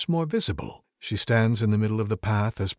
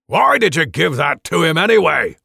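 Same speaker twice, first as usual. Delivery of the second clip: gruff question; 5 semitones higher, 9 dB louder than usual. Acted. Dwarf questions wizard.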